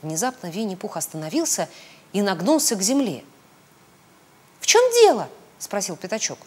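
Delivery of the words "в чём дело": high, loud squeaky question; Piglet's high-pitched query; anxious little piglet. A narrator's reading. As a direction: pipe up in alarm. Piglet asks startled Pooh's reason.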